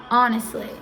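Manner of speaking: usual speaking voice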